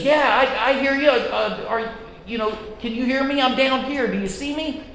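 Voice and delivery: calling up, a touch panicked; desperate reply. Retold or imitated. Man dangling from cliff answers voice.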